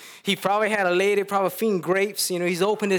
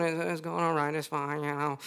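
much softer, low, defeated reply; dejected mumble; 3 semitones lower, 8 dB quieter than usual. Retold or imitated. Haman answering his household after a rough day, soft and dejected. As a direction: answer with your head down.

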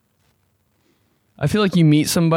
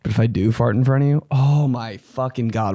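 usual speaking voice

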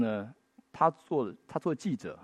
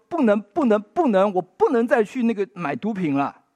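louder, higher retold cry of "no"; retelling a young addict's anguished vow, raised and urgent; desperate insistence; 7 semitones higher, 6 dB louder than usual. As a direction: push each 不能 harder, voicing the addict. Addict voiced pleading to himself.

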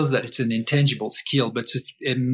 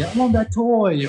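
put-on high, louder childish demand; lightly voiced child asking for a toy; mock whiny kid; 9 semitones higher, 4 dB louder than usual. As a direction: whine like a kid wanting a toy. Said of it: "or kids say".

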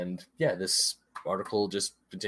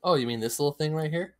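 coy, teasing; 8 semitones higher, 4 dB louder than usual. Pretend. Coy reveal of magazine.

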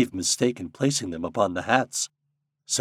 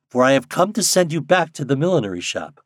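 slightly raised, louder character voice; lightly voiced Nuke; 6 semitones higher, 6 dB louder than usual. A narrator's reading. Popopo to the birds, announcing his errand firmly.

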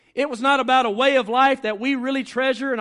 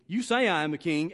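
normal talking voice

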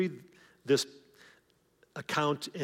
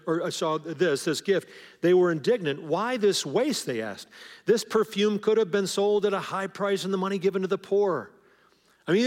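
louder, higher, driving sermon voice; preaching at full force; 4 semitones higher, 6 dB louder than usual. Real, not acted. Pastor presses the congregation about the disciples' criticism with fervent preaching. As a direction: preach it hard, building to the point.

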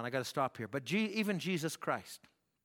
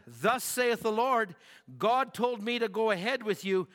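prophetic pronouncement; 6 semitones higher, 5 dB louder than usual. Retold or imitated. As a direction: proclaim it like a prophet.

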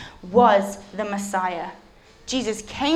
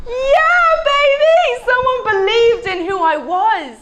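much higher, loud whoop of joy; elated Jesus; 12 semitones higher, 8 dB louder, slower than usual. Acted. Jesus overjoyed that someone believed in him. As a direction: celebrate like your dearest wish came true. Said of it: "He's like".

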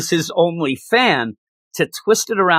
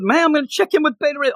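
higher, fast and eager; playful Scourge voice; 10 semitones higher, faster than usual. Retold or imitated. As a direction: rush it out like an excited buddy. Voicing Scourge's ghost.

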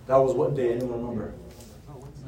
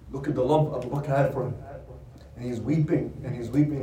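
usual speaking voice

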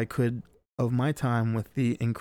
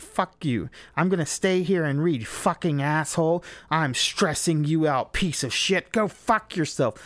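angry snarl; 4 semitones higher than usual. Retold or imitated. Girlfriend refuses the hike.

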